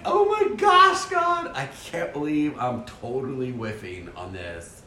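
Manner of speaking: high, drawn-out mock wail, easing down; exasperated inner wail. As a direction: wail to God in exaggerated despair, then deflate. Retold inner panic at failing.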